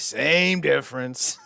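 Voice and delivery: dismissive dad. Pretend. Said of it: "dad's voice in the scene".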